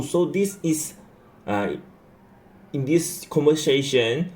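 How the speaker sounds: ordinary delivery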